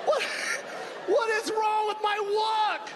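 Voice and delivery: high, outraged disbelief; shocked. Retold or imitated. Comic seeing his own odd walk, high shocked squeal. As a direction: squeal it in horror.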